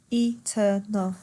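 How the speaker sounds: "Eternal" slowly split e-ter-nal; tiga suku kata. Shown as a demonstration. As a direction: give "Eternal" three separate beats. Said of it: "that's got its own syllable".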